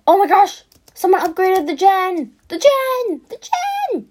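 high, loud excited shriek; real excitement. Real, not acted. Gamer spots the upgraded gen, real excited shrieking. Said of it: "I get so excited".

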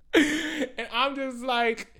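very high, loud, stretched-out words; hammed-up disbelief. Retelling everyone staring as she shakes, hammed-up disbelief. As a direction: drag it out high and loud in mock distress. Retells being stared at while shaking.

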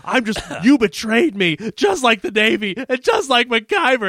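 imitating a paranoid man turning on his ally; mock meltdown. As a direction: start defiant, climb into hammed-up betrayed outrage. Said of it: "having a meltdown".

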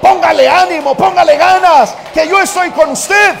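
loud, high rallying call from God; booming encouragement. Acted. Preacher voicing God, shouting encouragement to a nervous groom. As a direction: shout it like God cheering him on. God's voice urging in sermon.

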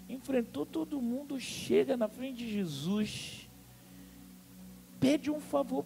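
ordinary talk, nothing marked

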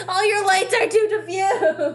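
high, loud comic complaint; loud pre-sneeze whine; exasperated wail. Real, not acted. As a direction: cry out in desperate, laughing frustration.

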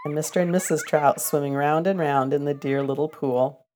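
ordinary delivery

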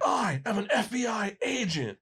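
high shouted movie line; hammed-up Point Break shout; mock shout. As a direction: yell it like an action hero. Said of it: "I go like".

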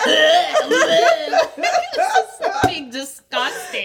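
imitating retching sobs, very high and strained; puke ugly crying. Coworker imitates Samantha at the Zoom audition, puke ugly crying. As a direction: squeal it through mock heaving tears. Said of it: "like, puke ugly crying".

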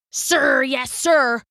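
lightly voiced soldier reply; a hint of a drill-trained grunt; barked sir yes sir. A narrator's reading. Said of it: "Rambo said".

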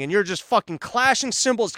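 ordinary talk, nothing marked

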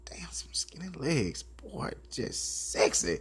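hushed unvoiced ogling; whispering under the breath at the legs. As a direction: breathe it out like a secret admirer.

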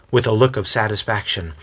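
usual speaking voice

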